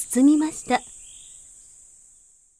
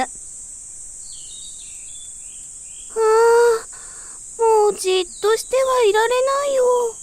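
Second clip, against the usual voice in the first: higher eager little voice; can't sit still any longer, pitched up; eager root; 8 semitones higher than usual. A narrator's reading. Root warmed by sunlight bursting to grow, higher eager voice. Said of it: "と花の根っこは言いました".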